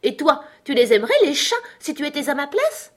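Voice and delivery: high, loud indignant mouse; piercing, furious voice. A narrator's reading. The mouse challenges Alice about cats, piercing and furious. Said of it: "d'une voix perçante et furieuse".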